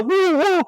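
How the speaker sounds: high, loud imitated dog woof. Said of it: "he deletes the".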